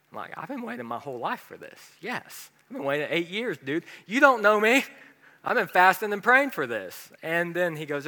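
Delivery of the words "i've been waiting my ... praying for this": eager. Retold or imitated. Eager pleading in retold scene.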